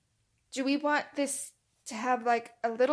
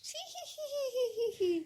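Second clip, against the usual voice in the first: high cackling laugh; witch cackle; 14 semitones higher, 4 dB quieter than usual. Real, not acted. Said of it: "Wicked Witch".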